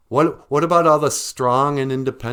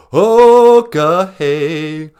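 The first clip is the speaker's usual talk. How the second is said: big booming okay; shouted show intro; loud show opener; 7 semitones higher, 12 dB louder than usual. Acted. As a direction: belt it out to start the show.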